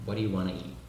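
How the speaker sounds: casual "waddayu wanna ee" for "What do you wanna eat"; American linked speech. Shown as a demonstration. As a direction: let the T of "eat" vanish. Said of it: "vai falar meio que".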